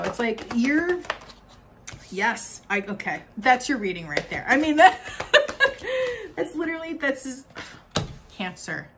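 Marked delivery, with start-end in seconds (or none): 5.0-6.3 high delighted laugh. Real, not acted. Tarot reader laughing with joy at the reading. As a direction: burst of high giggling.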